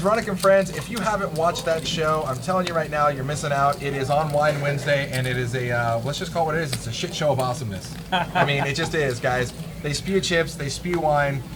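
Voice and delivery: normal talking voice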